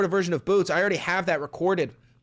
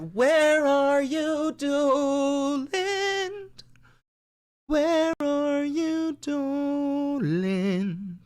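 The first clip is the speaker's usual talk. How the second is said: high, slow drawn-out call; sing-song it like calling a lost pet; 10 semitones higher, much slower than usual. Pretend. Streamer calling for Donald to show up.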